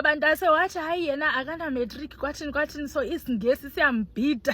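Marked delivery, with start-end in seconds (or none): none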